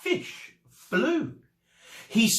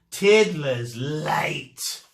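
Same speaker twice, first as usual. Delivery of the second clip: slow, stern; 3 dB louder, much slower than usual. Acted. Teacher marks pupil late.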